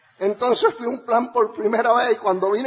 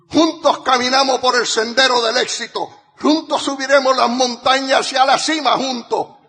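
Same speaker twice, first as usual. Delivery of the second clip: building rally shout; 6 semitones higher, 7 dB louder than usual. Acted. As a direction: rouse the crowd to their feet. Rallying call to followers.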